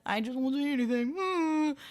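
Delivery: high, put-on mocking voice; hammed-up helpless refusal to speak; mock silent coward. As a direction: mimic him, rising into a high hum. Mocking a man who stays silent.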